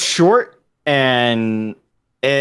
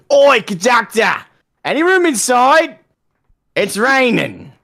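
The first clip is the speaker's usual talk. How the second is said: put-on high, loud British-accented holler; Cockney shout; 14 semitones higher, 5 dB louder than usual. Cockney infantryman calling to those inside the tank, high shout. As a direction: call out far above your usual pitch. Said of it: "shout to those inside the tank".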